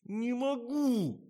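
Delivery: very high, long-drawn whine; wailing refusal. A narrator's reading. Tasya refuses to drink the syrup, wailing. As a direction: wail it like a balky child. Tasya wails that she cannot.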